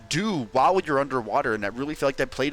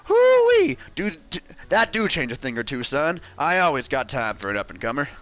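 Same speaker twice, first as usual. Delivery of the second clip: Grubba voice; 4 dB louder than usual. Acted. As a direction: holler it like a folksy old prospector. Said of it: "I'm gonna voice Grubba".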